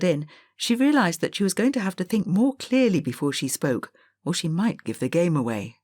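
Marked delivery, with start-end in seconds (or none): none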